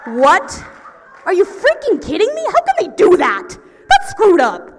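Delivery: high, shouted disbelief; outraged. Retold or imitated. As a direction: explode in hammed-up outrage. Outburst at being pulled over.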